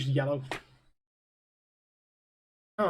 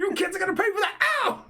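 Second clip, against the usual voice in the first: higher, fast mock yell; hammed-up angry homeowner; 13 semitones higher, faster than usual. Friend quoting angry homeowner, hammed-up yell.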